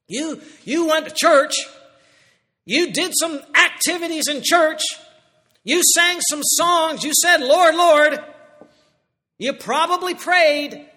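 loud, high, slow rebuke; stern rebuke. Acted. Jesus rebuking false believers, loud and high. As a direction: call out the churchgoers' empty religion.

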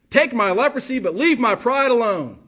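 proud demand. Retold or imitated. Mocking proud Naaman's demand.

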